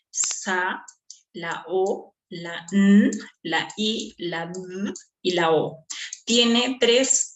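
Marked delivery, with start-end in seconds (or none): 0.1-5.8 "Sa, la O, la N" spelled out one sound at a time; each sound of "sonido" held and set apart. Shown as a demonstration. Teacher counts the sounds for the class, sound by sound. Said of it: "¿cuántos sonidos tienes?".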